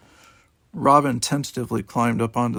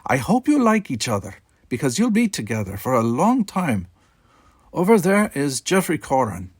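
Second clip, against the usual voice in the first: lightly voiced Russian accent, firm and final; a hint of a Russian commander's accent, giving a cryptic promise; Russian officer's accent, higher and louder; thick Russian accent; 4 semitones higher, 3 dB louder than usual. A narrator's reading. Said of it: "His thick Russian accent".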